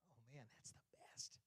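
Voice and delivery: breathless whisper; whispered savoring. Retold or imitated. Someone savoring a treat, whispered delight. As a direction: whisper it in bliss.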